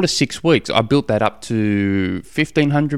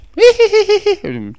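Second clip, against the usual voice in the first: imitated daughter's very high, drawn-out giggle; mock little-girl tittering, stirring up her brother; teasing giggle; 5 dB louder than usual. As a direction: squeal out a teasing hee-hee. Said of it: "Just my daughter stirring him up".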